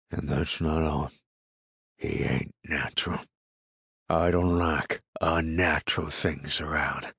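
ominous, confiding country farmer. A narrator's reading. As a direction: drop the voice and leave heavy pauses. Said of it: "In a lower tone".

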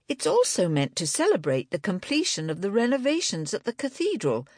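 high-pitched, chatty woman character; Angela, eager knitter. A narrator's reading. Angela telling Libby about yarn bombing, high and eager.

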